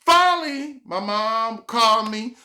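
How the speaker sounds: ordinary talk, nothing marked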